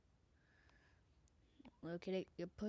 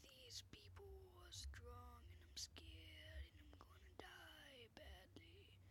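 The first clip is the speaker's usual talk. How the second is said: high, breathy-free hush of mock terror, slow; scared whisper; 9 semitones higher, 14 dB quieter, slower than usual. Gamer whispers fearfully to himself about strong players, high slow whisper. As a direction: whisper it like a frightened little creature, slowly. Whispering fear while playing.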